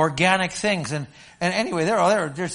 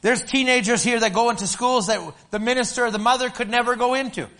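raised, ringing preacher voice; preaching; 7 semitones higher, 3 dB louder than usual. Acted. Pastor preaching to his congregation, high and ringing. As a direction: preach it out high and strong to the church.

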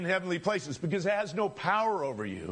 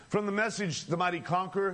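usual speaking voice

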